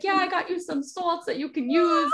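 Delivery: high-pitched, lightly voiced eager kid; excited little boy. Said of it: "little Peter was so excited".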